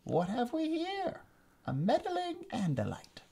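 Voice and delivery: high, slow sneering alien villain. Acted. Alien commander gloating over captured prince, high sneering villain voice. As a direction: savor every word like a gloating tyrant. Visser voice.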